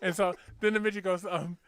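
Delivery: ordinary talk, nothing marked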